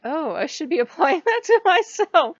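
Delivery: high, loud laugh through the words; laughing at herself. Real, not acted. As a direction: crack up at your own realization. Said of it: "I'm like".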